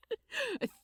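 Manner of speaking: a quick high happy laugh; bright little giggle of delight. Acted.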